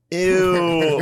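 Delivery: high, loud grossed-out "Ew"; hammed-up teen disgust; mock disgusted girls. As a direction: squeal it like disgusted teens. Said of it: "they're all gonna be like".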